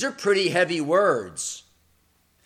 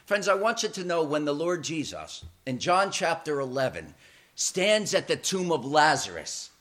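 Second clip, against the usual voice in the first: ordinary talk, nothing marked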